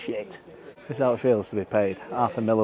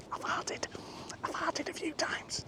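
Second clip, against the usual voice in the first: quick voiceless whisper of "farted", much softer; whispered confession; 17 dB quieter, slower than usual. Real, not acted. Nervous penalty taker confides to viewers in a hush.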